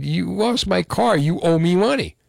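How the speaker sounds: retold confrontation. Host retells confronting the friend who lost his car, indignant demand.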